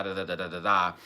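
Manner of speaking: normal talking voice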